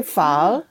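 pho eased out slowly like a question; slow down on pho, asking it. Shown as a demonstration. Teacher correcting a learner's attempt, slow questioning tone. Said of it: "just slow down a little bit".

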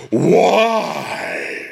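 loud, long, rising mock wail; imitated incredulous why. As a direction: stretch one word into a high cry. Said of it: "And Garcelle goes".

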